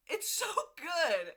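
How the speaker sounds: high, slow, loud gush over so good. Real, not acted. Vlogger gushes to viewers about the book, high and drawn out. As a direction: stretch it out, swooning.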